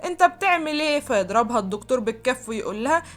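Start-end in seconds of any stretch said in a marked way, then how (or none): 0.0-1.0 higher-pitched alarmed question; frightened. A narrator's reading. Patient to the doctor closing in on her, frightened. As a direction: ask it in startled fear, voice raised in pitch. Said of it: "فتخاف منه جدًا".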